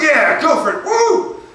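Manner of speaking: loud, high whooping cheer; excited American. Acted. British comic mocking patriotic Americans for the audience, excited whooping. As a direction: whoop it up with over-the-top enthusiasm. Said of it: "you just get very excited and patriotic".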